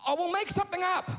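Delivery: high-pitched acted father; exasperated dad. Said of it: "And Mike goes".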